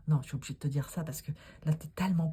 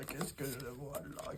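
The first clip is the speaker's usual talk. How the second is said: much softer, slow, hushed; fading mystic murmur; 3 semitones lower, 13 dB quieter than usual. Pretend. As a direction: let the question drift away mysteriously. Fortune teller repeats, fading. (murmure mystérieux)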